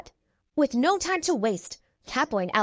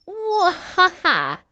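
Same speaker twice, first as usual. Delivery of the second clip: slow, higher gloating cackle; villain laugh; 6 semitones higher, 4 dB louder, much slower than usual. A narrator's reading. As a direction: cackle like a proud little villain. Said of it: "Romeo laughs".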